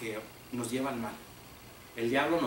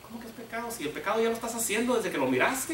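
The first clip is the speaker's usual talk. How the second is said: scornful retort; 8 semitones higher than usual. Acted. Brothers argue over killing him.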